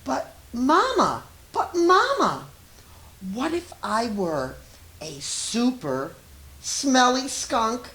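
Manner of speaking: put-on high, loud little-child voice, eager and wondering; pleading child. A child in bed asking Mama a big question, high and eager. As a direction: squeak it up as an excited small child.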